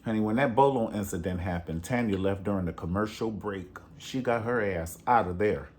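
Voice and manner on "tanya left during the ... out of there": usual speaking voice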